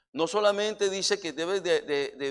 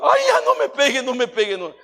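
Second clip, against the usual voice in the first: much higher, whimpering plea; hammed-up cry for mercy; pitiful tecolín; 8 semitones higher, 10 dB louder than usual. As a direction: squeal like a beaten little thief begging. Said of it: "el pobre tecolín dijo".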